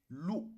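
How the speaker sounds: "lou" swept up to a high tone; drawn-out, high-pitched "lou". Shown as a demonstration. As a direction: raise the voice on "lou". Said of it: "on fait une élévation dans la voix".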